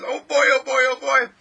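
very loud, high exultation; overjoyed divine voice. Acted. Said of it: "that's the way the divine talks".

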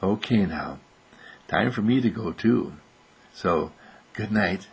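low, soft bedtime farewell; gentle goodnight. Real, not acted. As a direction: say goodnight low and softly, winding down. Saying goodnight to the child.

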